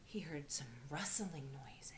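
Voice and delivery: soft, breathy-hushed suspense read; hushed suspense narration. A narrator's reading. Teacher building suspense in a read-aloud, hushed. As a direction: make the children lean in, hushed.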